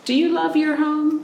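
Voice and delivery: normal talking voice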